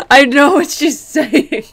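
loud, high talk through laughter; helpless laughter breaking into the words; laughing. Real, not acted. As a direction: crack up while trying to speak. Cracking up over the dolphins mix-up.

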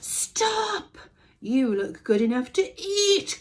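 high, loud crowing call; crowing cockerel. A narrator's reading. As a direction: crow it out like a rooster. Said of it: "crowed the cockerel".

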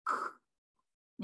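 soft voiceless "ク" burst; isolated K sound, "ク", without a vowel. Shown as a demonstration. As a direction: release a bare K, "ク". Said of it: "音として現れる時のKの音です".

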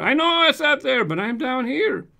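high, loud protest, slowing at the end; exasperated retort. Real, not acted. Host answering commenters who keep saying it's up there, exasperated. Mock complaint back at repeated commenters.

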